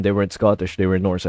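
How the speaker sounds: plain reading voice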